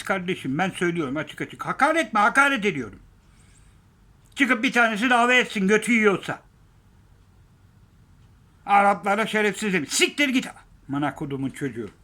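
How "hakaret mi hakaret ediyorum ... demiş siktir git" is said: loud, high, challenging yell; defiant shouting. Real, not acted. Vlogger daring critics, loud defiant shout. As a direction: dare them to sue, shouting.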